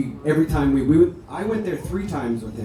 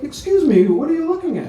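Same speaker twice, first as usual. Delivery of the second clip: high, louder mock-suspicious lady; a hint of a suspicious passer-by; lightly voiced nosy lady; 4 dB louder than usual. Lady questions them at the marquee.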